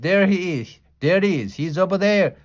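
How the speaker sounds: high, loud, bouncy pointing; very excited. A narrator's reading. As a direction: jump up and down pointing him out. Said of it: "very excited".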